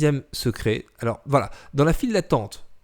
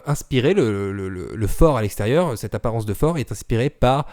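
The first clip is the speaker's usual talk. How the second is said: ordinary talk, nothing marked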